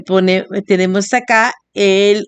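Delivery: normal talking voice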